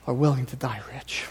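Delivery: hushed, unvoiced whisper of the key line; whispered point. Pastor to congregation, driving home the moral in a whisper. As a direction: breathe it like a grave confession.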